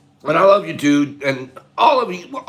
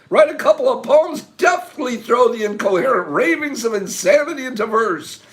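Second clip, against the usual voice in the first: 1 excited recap; 9 semitones higher than usual. Real, not acted. Gleeful recap of the quote.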